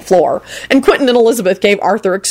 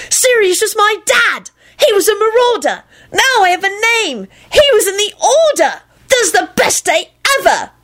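delighted shrieking. A narrator's reading. Boy thrilled to learn who his father was, delighted shrieks. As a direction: crow each discovery in a raised squeal. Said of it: "Timothy shrieked delightedly".

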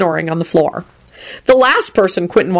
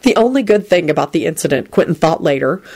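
ordinary delivery

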